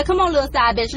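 normal talking voice

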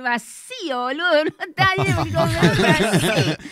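cracking up at the punchline; real laughter breaking into the speech; laughing through the words. Real, not acted. Se tienta con su propio chiste. (hablando tentada de risa)

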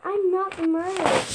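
loud, insistent protest; shouting denial. Real, not acted. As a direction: shout that you're innocent.

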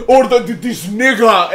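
put-on high, loud Egyptian-accented outburst. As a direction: imitate the Egyptian customer yelling over everyone. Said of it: "all you hear from him is".

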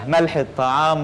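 ordinary talk, nothing marked

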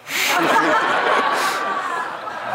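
helpless high laugh; high shrieking laugh. Real, not acted. Punchline laugh.

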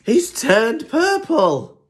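high, loud, drawn-out astonishment; shocked exclamation. Acted. Penguin seeing Zebra change colour, shocked exclamation.

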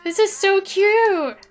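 high, loud, slow delighted gush; drawn-out delighted coo. Real, not acted. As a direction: squeal over how cute it is. Gushing over cute Sims.

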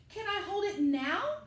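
put-on a small boy calling out hopefully; little Sam's voice. Sam asks the creatures.

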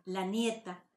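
normal talking voice

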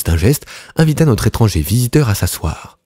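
usual speaking voice

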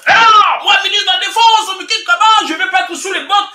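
high, loud, outraged protest; mock the minister refusing the job; indignant politician. Commentator voicing Kunzu refusing the vice-presidency, high indignant shout.

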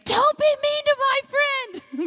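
high, slow mock scream of a furious little girl; hammed-up high-pitched childish yell; child's retold scream. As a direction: shriek it the way a small child defends a friend. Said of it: "she was screaming".

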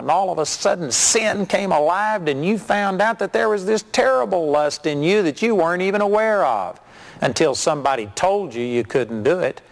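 ordinary talk

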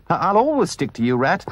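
high, stammering devotion; loyal. A narrator's reading. Mole pledging himself to Rat, loyal. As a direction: pledge it with an eager stumble. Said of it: "said the Mole loyally".

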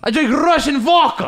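high mock Russian accent; arguing Russian. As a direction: boast in a hammed-up Russian accent. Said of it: "a street argument".

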